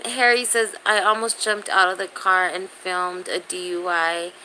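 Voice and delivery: ordinary talk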